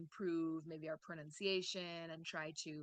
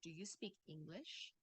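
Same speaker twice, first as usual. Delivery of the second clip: softly ask, lifting ENGLISH upward; rising yes-no question; 2 semitones lower, 9 dB quieter than usual. Shown as a demonstration. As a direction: pitch climbs at the end on "English". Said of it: "hear the intonation rise a little bit".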